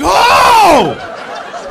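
mock shocked cry, high and loud; blown-away kid. Six-year-old reacting to being flipped off, shocked cry. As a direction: imitate a stunned child's outburst.

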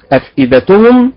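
first and correct way to say أفئدتهم. Shown as a demonstration. Teacher giving the right reading first. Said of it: "الأداء الأول هو الصحيح".

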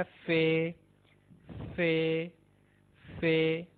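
"फे" said like English F; letter F. Shown as a demonstration. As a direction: say "फे" with an F sound. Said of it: "similar to English". ("फे" अंग्रेज़ी एफ जैसा)

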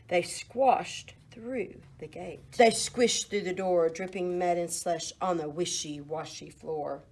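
plain reading voice

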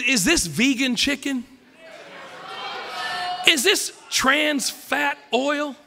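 ordinary talk, nothing marked

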